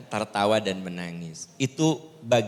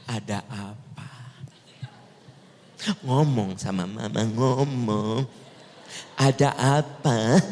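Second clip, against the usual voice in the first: lightly voiced mum prodding her child; imitating mother; 2 semitones lower, slower than usual. Mother quizzes her child in her room, lightly mimicked. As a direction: nag gently, as a mother imitated. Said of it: "Suka gitu tuh?".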